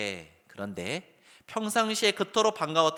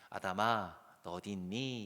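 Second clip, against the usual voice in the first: quiet, lower, tender calling; soft gentle call; 4 semitones lower, 7 dB quieter, slower than usual. Shown as a demonstration. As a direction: call him softly so he dares to come out. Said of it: "이렇게 부르신 거예요". (조용하고 부드럽게 부르는 하나님의 음성)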